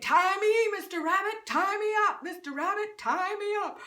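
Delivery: high loud pleading cries; screaming animals. Acted. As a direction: yell it, begging for rescue. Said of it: "they all start screaming".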